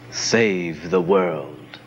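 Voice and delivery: slow, low and rueful. A narrator's reading. Wealthy men at their offices, speaking ruefully. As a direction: sigh it out with regret. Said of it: "they spoke ruefully".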